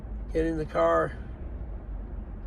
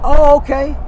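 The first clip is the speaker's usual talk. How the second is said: surprised; 9 semitones higher, 12 dB louder than usual. Retold or imitated. Surprised, drag queen explained.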